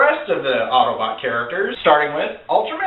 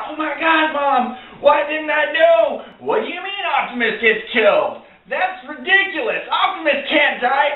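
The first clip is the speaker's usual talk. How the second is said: put-on excited kid shock; 8 semitones higher than usual. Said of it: "I was like".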